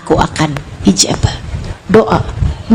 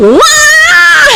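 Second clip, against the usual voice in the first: sudden high loud wail; imitated child wail; 13 semitones higher, 3 dB louder than usual. Imitating a child crying in earnest, loud wail. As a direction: burst into a child's real crying shout. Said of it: "anak nangisnya serius nih".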